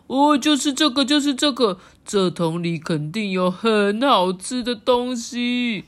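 high, loud, then slow and greedy; eager frog. Acted. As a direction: point at the pail, thrilled. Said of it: "第二只青蛙就指着这个奶桶里说".

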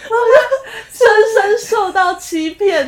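mock wounded. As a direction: play the betrayed child with a fake whimper. (假哭着说被骗)

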